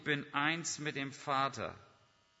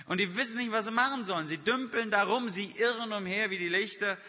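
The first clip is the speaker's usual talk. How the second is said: preaching at full force; 6 semitones higher, 5 dB louder than usual. Acted.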